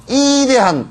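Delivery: high, stretched Gyeongsang-accented "이대한", 위 turned to 이; hammed-up Gyeongsang pronunciation; Gyeongsang dialect. Shown as a demonstration. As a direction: say "이대한" in broad Gyeongsang dialect, first vowel held high. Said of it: "경상도 말로 하면".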